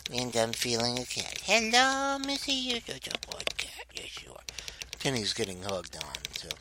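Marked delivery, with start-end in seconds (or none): 1.4-2.2 pet voice. Real, not acted. Cooing to his pet dog. 2.2-4.4 soft fond whisper to a pet. Real, not acted. Whispering fondly to his pet.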